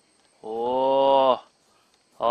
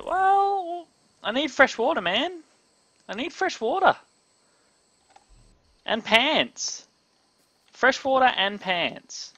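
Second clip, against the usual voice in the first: whiny complaint; 11 semitones higher than usual. Real, not acted. Complaining about needing water and pants.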